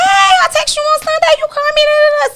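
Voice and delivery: high, loud excited hello; hyped greeting. Retold or imitated. Answering her friend's early call, high excited greeting. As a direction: bounce in with a big high greeting. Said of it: "So I'm just hyped".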